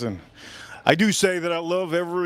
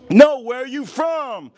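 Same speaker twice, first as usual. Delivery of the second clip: higher, louder and slowed, each word hammered; louder insistent teacher; 8 semitones higher, 6 dB louder, slower than usual. Retold or imitated. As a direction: demand it again, losing patience. Teacher repeats, more forcefully.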